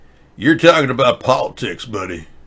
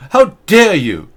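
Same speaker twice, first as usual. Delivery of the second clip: higher, louder hammed-up scolding; mock Greta outrage; 8 semitones higher, 6 dB louder, slower than usual. Host mocks Greta scolding a chat user, loud and high. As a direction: bark it in mock indignation.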